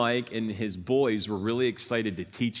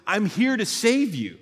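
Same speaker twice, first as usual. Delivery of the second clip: high, mildly raised mock yell; retold yelling; 9 semitones higher, 3 dB louder than usual. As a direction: act out a lifeguard calling to a swimmer. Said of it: "like, yelling at me".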